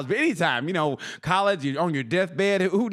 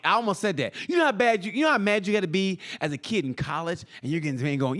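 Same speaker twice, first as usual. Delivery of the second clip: ordinary talk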